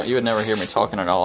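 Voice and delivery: normal talking voice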